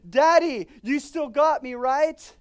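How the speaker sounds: loud, high cry for help; desperate plea. Acted. The soul calling out to God, a loud high desperate plea. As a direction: cry out like a frightened child.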